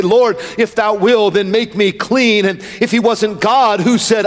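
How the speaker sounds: driving, rhythmic pulpit questions; impassioned sermon chant; full-force preaching. Real, not acted. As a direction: preach it as a relentless rolling litany.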